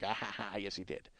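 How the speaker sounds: very soft, low chuckled aside; quiet mock gloating. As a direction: gloat softly to yourself. Secretly gloating at autograph crowd.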